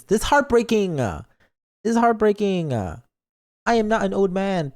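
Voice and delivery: high, hammed-up wounded protest; mock heartbroken. Streamer hurt at being called an old man, mock heartbroken. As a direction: wail it as pretend hurt.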